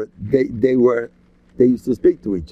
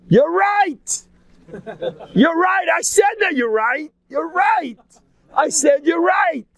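screaming at top of lungs; slower than usual. Retold or imitated. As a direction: yell the concession at full lungs, imitating. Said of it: "he was screaming at the top of his lungs".

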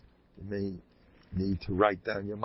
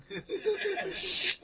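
high, wheezy chuckle; amused laugh; 5 dB quieter than usual. Real, not acted. Rabbi after admitting a one-track mind, amused laugh.

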